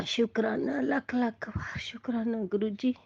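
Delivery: breathy whispered thanks, very soft; हल्की फुसफुसाती प्रार्थना; whispered prayer. Real, not acted.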